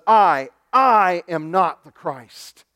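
"I, I" hit hard and slowly, with a doubled pronoun; emphatic denial. Acted. John denying to questioners he is Christ, emphatic stress on "I, I". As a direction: stress both "I"s in a slow, forceful denial. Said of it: "trying to be as emphatic".

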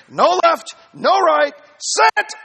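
high, loud donkey order; donkey's command. Acted. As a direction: plant your hooves and bark it out. Donkey voiced in story.

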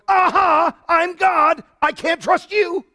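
high, loud triumphant aha; God's mock reply. Preacher voicing God's triumphant answer, high and loud. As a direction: turn the tables with a gloating aha. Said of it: "God eventually goes".

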